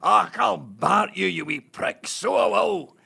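put-on shouted, high broad Glasgow accent; hammed-up Scottish sleep-shouting; mock Glaswegian rant. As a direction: bellow it like an angry Glasgow drunk. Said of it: "I've heard you in your sleep say".

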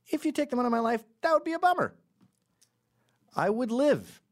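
very high put-on squeaky voice; mock lament. Man imagining life without Shamrock Shakes, high mock-distraught squeal.

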